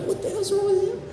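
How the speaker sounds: high, baffled mock question; retold bafflement. Wondering why Andrew cries, high and baffled. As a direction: pitch it way up in mock confusion. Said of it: "I'm like".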